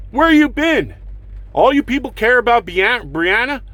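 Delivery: high, pointed accusing question; angry demand. Acted. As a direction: call them out hard.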